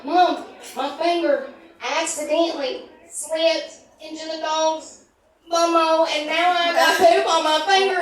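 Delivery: put-on high, loud distressed girl; panicked daughter. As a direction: whine it out in horror. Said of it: "And she said".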